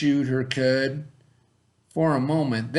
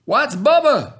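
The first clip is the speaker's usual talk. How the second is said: much higher, louder yell; mocking shout; 11 semitones higher, 9 dB louder than usual. Dwayne shouting at the ball, high and loud. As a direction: shout it across the room. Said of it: "shouted Dwayne".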